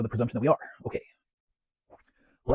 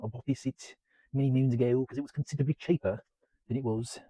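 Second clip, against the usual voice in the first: hammed-up, badly faked British accent; shitty British accent. Vlogger telling viewers where the box set was bought, fake British accent. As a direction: put on a bad British accent. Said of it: "that is my shitty British accent".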